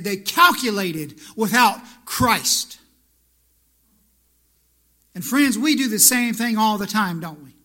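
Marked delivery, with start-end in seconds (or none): 0.0-2.8 emphatic quote. Retold or imitated. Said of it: "he said".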